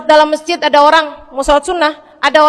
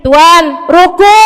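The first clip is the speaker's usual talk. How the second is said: calling louder near his ear; louder reminder; 4 semitones higher, 6 dB louder, much slower than usual. Acted. Servant calling the praying Umar louder to ruku. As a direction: raise the voice so he hears. Belum kedengeran juga.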